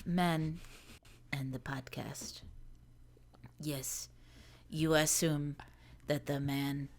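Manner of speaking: low, soft, halting European narrator; French announcer from SpongeBob. Acted. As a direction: intone softly, drifting into a French accent. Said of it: "I'm getting the French announcer from SpongeBob".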